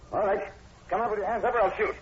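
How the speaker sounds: loud, high commanding shout; shouted order. Acted. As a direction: bark it at the figure in the bush. Sergeant confronts hidden man.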